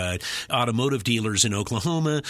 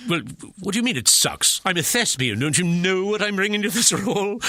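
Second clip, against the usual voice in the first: higher, mock-offended thespian; indignant actor; 8 semitones higher than usual. Actor to the booth after criticism, hammed-up indignation. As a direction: protest like a wounded stage actor. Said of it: "And you're like".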